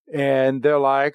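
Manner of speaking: normal talking voice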